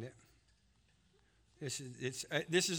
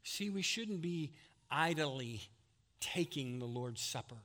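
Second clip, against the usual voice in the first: ordinary delivery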